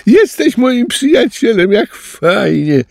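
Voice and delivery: very high, loud happy squeal; squealing with joy. A narrator's reading. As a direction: squeal like a delighted little tapir. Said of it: "kwiczał już nie ze strachu, ale z radości". (radosne kwiczenie, bardzo wysoko)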